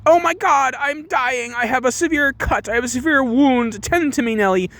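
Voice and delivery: high-pitched mock wailing; melodramatic hypochondriac. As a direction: wail as if a paper cut were fatal. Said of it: "applying the melodramatics".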